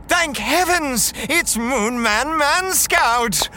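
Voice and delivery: high, slow, gushing cry of relief; relieved hero worship. Acted. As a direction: gush over your rescuer. Rescued, hails the hero.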